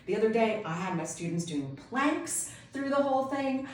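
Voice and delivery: ordinary talk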